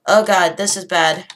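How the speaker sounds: ordinary talk, nothing marked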